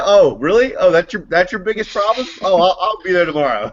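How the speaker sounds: high, laughing, sarcastic oh really; sarcastic mock reply. Sudanese immigrant scoffs at the privilege claim, laughing sarcasm.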